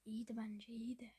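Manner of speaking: normal talking voice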